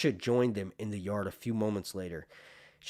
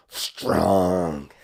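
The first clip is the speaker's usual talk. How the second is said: lower, much louder roar like a metal singer; metal scream; 4 semitones lower, 11 dB louder than usual. Acted. The dying maester urges Bran on with a metal roar. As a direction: growl it out like heavy metal. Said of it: "Maester Lewin just went metal on him".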